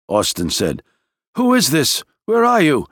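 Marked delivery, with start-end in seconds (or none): 1.4-2.9 shouting over radio. A narrator's reading. Gant shouting back over radio.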